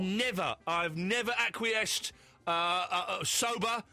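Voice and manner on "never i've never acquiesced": never said slowly and deliberately; slow, emphatic. Real, not acted. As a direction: drag out never acquiesced.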